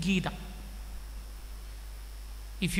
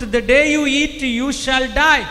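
loud command; 4 semitones higher, 10 dB louder than usual. Acted. Quote said as God.

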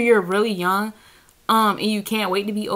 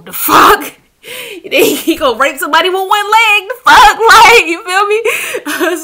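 high, loud laughing outburst; cracking up at the absurd idea, half crying; laughing disbelief; 10 semitones higher, 10 dB louder than usual. Real, not acted. Telling viewers, cracking up in loud laughing disbelief.

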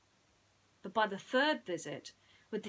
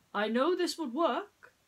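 high, loud insistent prince; nervous prince; 8 semitones higher, 8 dB louder than usual. Acted. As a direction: insist with growing doubt. Prince spins in vain.

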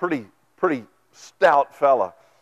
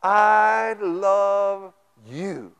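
I love you, drawn out; 3 semitones higher, much slower than usual. Shown as a demonstration. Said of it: "you are screaming to him in his language".

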